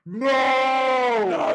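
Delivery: high, loud shout of dismay; mock outrage. Real, not acted. Co-host reacting to the bone riders, shouting no. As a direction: cry out no in playful horror.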